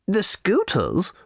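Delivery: put-on high, slow old lady; slow and prim, very high; little old lady voice. A narrator's reading. Booth lady refusing Arthur, high and slow.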